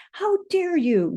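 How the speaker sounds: high outraged shout. Acted. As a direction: shout it at him, indignant. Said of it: "you shout".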